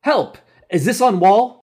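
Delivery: high, loud cry for help; desperate call. A narrator's reading. Wounded soldier calling to the camp for help, desperate. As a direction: call out urgently, pitched high.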